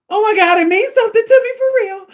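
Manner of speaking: loud, fast, high sobbing cry; crying outburst. Retold or imitated. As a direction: blurt it out as the tears hit. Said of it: "that's what made me cry".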